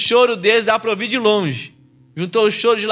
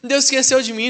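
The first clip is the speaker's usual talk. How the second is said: mock complaint; 7 semitones higher than usual. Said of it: "Eu falo assim".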